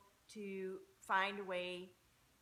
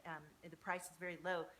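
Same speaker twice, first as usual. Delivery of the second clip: ordinary talk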